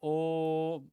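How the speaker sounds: ओ held very long; prolonged ओ as in chanting; pluta o. Shown as a demonstration. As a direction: draw ओ out to triple length. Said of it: "ओ को लंबा करने के लिए".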